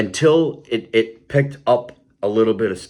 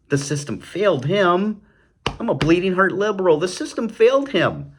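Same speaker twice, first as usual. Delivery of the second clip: high, whiny parody of a liberal, pitched way up; mock bleeding heart liberal; 10 semitones higher than usual. Mocking liberals, a high whiny caricature. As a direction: sing it out high like a preachy liberal.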